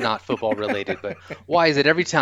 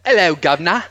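a light cockney greeting; mock cockney accent; 4 semitones higher, 5 dB louder than usual. Shown as a demonstration. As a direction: tip your hat like a cockney. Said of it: "you want me to do that? I can do that".

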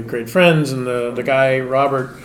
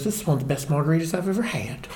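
put-on softer Southern lady accent, a laugh in it; a Southern lady praising the bartender's margarita, soft Southern accent; 2 semitones higher, 6 dB quieter than usual. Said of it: "It's a really good accent".

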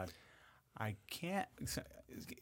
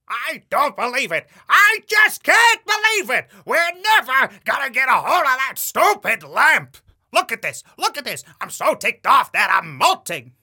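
shrill-free? no: much higher, shouted, raspy parrot rant; Gilbert Gottfried as Iago; 14 semitones higher, 20 dB louder than usual. Pretend. Voice actor answers a request with Iago, much higher and shouting. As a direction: screech it like an outraged cartoon parrot. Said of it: "say a line for me as Gilbert".

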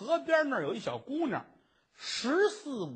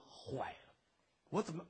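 dread whisper; 4 semitones lower, 10 dB quieter than usual. Acted. Dread after killing.

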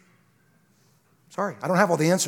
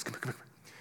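whisper; 14 dB quieter than usual. Pretend. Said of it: "God looked down and said".

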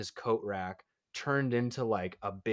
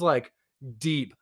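ordinary talk

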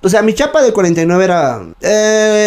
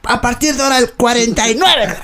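high parade-ground proclamation, imitated; sergeant; 6 semitones higher than usual. Sergeant naming the recruit forty-nine, imitated high military voice. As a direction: pronounce the nickname like a sergeant. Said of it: "mi sargento".

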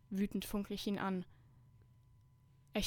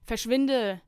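loud, higher-pitched furious shout at the door; angry snapping; 3 semitones higher, 7 dB louder than usual. A narrator's reading. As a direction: yell it to chase him away. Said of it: "schnauze ich in Richtung Tür". (zornig angeschnauzt)

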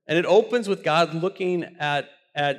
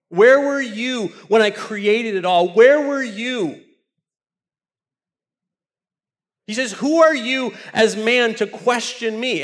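full-force preaching; 5 semitones higher, 6 dB louder than usual. Acted.

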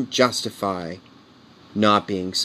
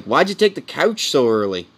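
lightly voiced kids, pitched up; mock kid complaint, higher; imitating complaining kids; 5 semitones higher than usual. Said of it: "The kids are like".

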